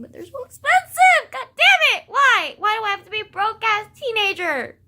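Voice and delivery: high, loud frustrated complaint; exasperated wail. Real, not acted. Broke teen venting about drag show prices, loud wail.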